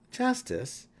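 high, incredulous question; scornful disbelief. A narrator's reading. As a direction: scoff at the absurd notion. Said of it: "laughed at the absurd notion".

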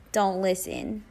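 ordinary talk, nothing marked

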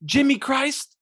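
high, sharp disbelieving question; incredulous interruption. Acted. God cutting in on the baby's name, high incredulous question. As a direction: cut in high, appalled by the name. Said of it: "then he cuts her off".

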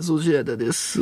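ooze in with stretched syllables; 粗品任三郎. Acted. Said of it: "粗品任三郎さん。". (刑事ドラマのものまねで、間延びした声)

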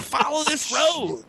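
high, slow mock shout; mock yell. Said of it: "I'm, like, screaming at this guy".

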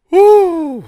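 high, loud winded woo; loud exhale woo. Retold or imitated. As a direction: blow it out after a yawn. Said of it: "You're like".

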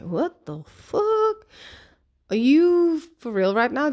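high, drawn-out incredulous outburst; indignant disbelief. Retold or imitated. Mum reacting to stranger's parenting rebuke, indignant disbelief. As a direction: stretch it out slowly and high, then challenge.

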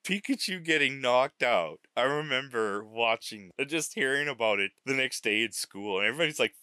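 usual speaking voice

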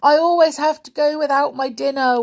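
high, loud, self-pitying wail. A narrator's reading. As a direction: whine about missing dinner. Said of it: "wailed Winks".

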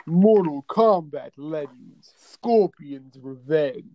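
slow, dramatic trailer announcer; dramatic title announcement. Pretend. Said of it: "that was a really cool way of saying it".

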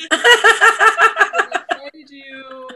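loud, slow laughing gasp. Real, not acted. Interviewer cracking up at the Yahtzee joke, laughing. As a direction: laugh out the words.

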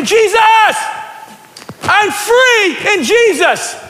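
high shout with long drawn-out words; jubilant shout. Acted. Preacher cheering for Jesus like at a game, jubilant shout. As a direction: cheer it like a football fan. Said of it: "We shout at a football game".

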